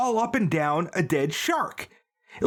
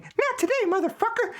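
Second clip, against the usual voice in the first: shrill-high defiant comeback; defiant high squeal. Acted. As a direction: squeal it high as she stabs.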